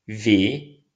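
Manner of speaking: ordinary talk, nothing marked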